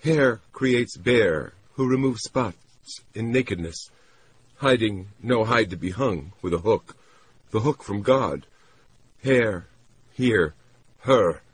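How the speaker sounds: high, laboured word-by-word speech; painfully deliberate, dazed woman's voice; slow and painstaking. Acted. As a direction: set each word down separately, pitched high, with great care. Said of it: "speaking slowly and painstakingly".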